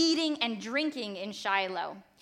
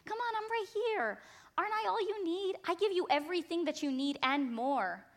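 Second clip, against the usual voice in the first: husband pleading; 7 semitones higher than usual. Retold or imitated. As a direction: coax her gently like a puzzled husband. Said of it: "Elkanah goes to her and he goes".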